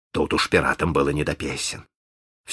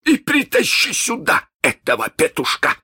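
iron voice; 3 dB louder than usual. Acted. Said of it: "своим самым железным голосом".

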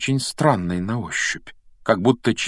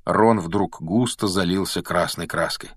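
ordinary delivery, nothing marked

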